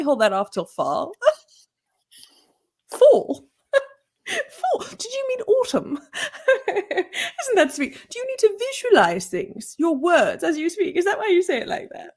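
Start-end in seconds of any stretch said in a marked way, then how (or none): 2.9-4.8 high-pitched incredulous mock-British "Fall"; British accent. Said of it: "British colleagues". 4.9-12.1 high, patronizing English colleague; British accent. Acted. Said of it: "British colleagues".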